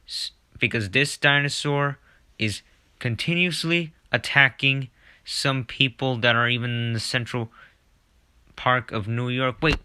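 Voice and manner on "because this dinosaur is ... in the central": ordinary delivery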